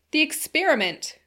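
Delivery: high, loud exclamation; crying out. A narrator's reading. Brain-weary Philby demanding to see the experiment, crying out. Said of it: "cried Philby".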